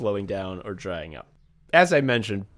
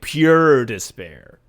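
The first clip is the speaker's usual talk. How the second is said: "pure despair" louder and slow; 8 dB louder, much slower than usual. Shown as a demonstration. Host repeats the phrase for effect, louder and slow. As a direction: lean hard into "pure despair".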